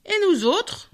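high indignant wolf cry; mild howl. A narrator's reading. Wolves claiming strength among the beasts, high howled protest. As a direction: swoop the voice up as wolves demanding their share. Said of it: "hurlèrent les loups".

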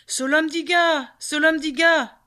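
high, drawn-out call across the forest. Acted. Indiga calling for his vanished brother, a slow high cry. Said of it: "Mais il avait beau crier".

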